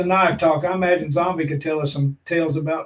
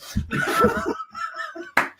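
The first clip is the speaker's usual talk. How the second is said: high airy laugh; 7 dB quieter than usual. Real, not acted. Friend in funny hat.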